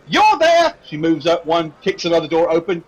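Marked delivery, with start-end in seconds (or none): 0.1-0.7 high, loud room assignment; exasperated serving girl calling out; brusque barmaid. Acted. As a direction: dispatch a guest to a room impatiently. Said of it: "She goes".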